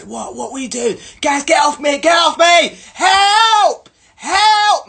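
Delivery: high, loud staged shouts for help; faked cries for help. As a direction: ham up a fake attack scream.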